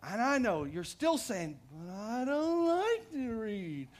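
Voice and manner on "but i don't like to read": put-on slow, drawn-out childish whine; higher, stretched-out mock whining; whiny voice. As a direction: whine it like a complaint to mom. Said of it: "might even say it in a whiny voice".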